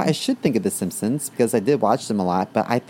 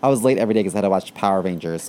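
normal talking voice

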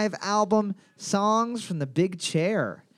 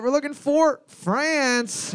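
host reveal to the teams; drawn-out host reveal; 8 semitones higher, 3 dB louder, much slower than usual. Shown as a demonstration. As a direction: stretch it out slow and high like a quiz host. Game host announces the answer.

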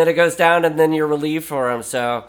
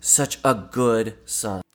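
low, slow, softer quote; overheard admiring woman; 5 semitones lower, 6 dB quieter, slower than usual. Retold or imitated. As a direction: say it slowly and quietly, as if to a seatmate. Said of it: "he overheard one of them go".

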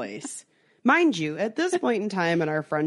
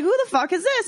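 alarmed demand; 12 semitones higher, faster than usual. Retold or imitated. Alarmed retort to stranger.

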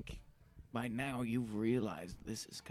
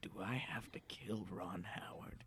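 whispering; 3 semitones lower, 6 dB quieter than usual. Acted. Secret plotting in crawl space.

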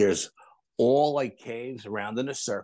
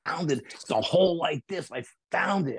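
excited shout; 5 semitones higher than usual. Retold or imitated. Cousin shouting his discovery from the water, excited. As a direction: call it out like a thrilled kid. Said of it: "he's really excited".

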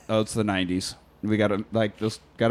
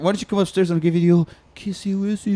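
cooing sweet-talk; 7 semitones higher than usual. Retold or imitated. Boyfriend caressing the intercom, cooing. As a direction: coax in a silly raised lover voice.

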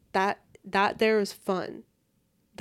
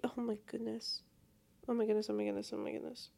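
hushed gasp; 8 dB quieter, faster than usual. Real, not acted. Realizing it's almost bedtime.

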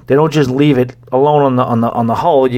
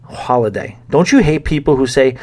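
ordinary delivery

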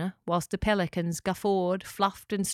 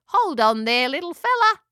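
loud, high-swooping character voice; pelican rescuer; 8 semitones higher, 7 dB louder than usual. A narrator's reading. Louise reassures the tangled echidna, loud pelican voice. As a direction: call encouragement to a little one.

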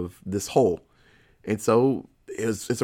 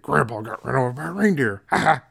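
a hint of the evil Santa, mock gloating; faster than usual. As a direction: tease the line like a smug villain, then a little laugh. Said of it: "says the line out loud".

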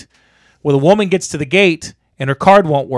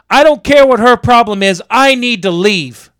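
raised, higher indignant voice; impatient, angry driver; 6 semitones higher, 4 dB louder than usual. Retold or imitated. Impatient driver confronting him at the gate, mock indignant. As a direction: imitate a driver demanding to get out.

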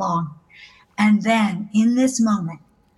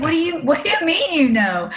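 pitched-up, incredulous retort; startled disbelief; 7 semitones higher than usual. Retold or imitated. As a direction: replay the startled question, voice jumping higher. Retells own startled reply.

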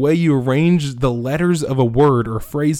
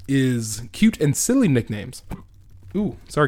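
normal talking voice

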